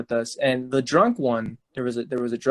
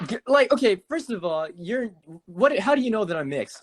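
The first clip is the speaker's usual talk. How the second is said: retold indignant reply; 6 semitones higher than usual. Speaker retells his indignant reply.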